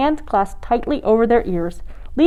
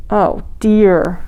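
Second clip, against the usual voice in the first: usual speaking voice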